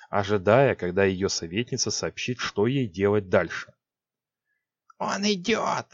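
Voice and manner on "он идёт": high hissing witch voice; hissing Six. A narrator's reading. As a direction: hiss it like a sorceress. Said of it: "прошипела Сикс".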